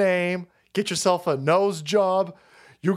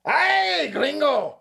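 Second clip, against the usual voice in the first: high mock yell, voicing the shot woman; a woman just shot, yelling at the cop; 9 semitones higher, much slower than usual. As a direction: squeal it like she barely felt the bullets. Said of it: "was just like".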